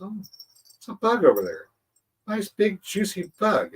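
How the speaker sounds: high, slow greedy bug voice; a little predator eyeing its lunch, slow and high; wheel bug voice. Acted. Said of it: "And he goes".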